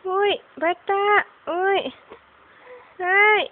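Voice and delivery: usual speaking voice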